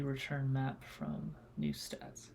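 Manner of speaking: whispered, slow reading of code; whispering code. Real, not acted. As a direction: read the line under your breath.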